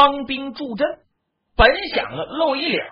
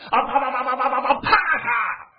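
fast crackling clash sounds; vocal sound effects of the fight; imitated weapon clashes; 4 semitones higher than usual. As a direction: spit out the blows like a fight.

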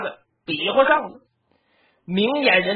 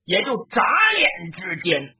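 ordinary delivery, nothing marked

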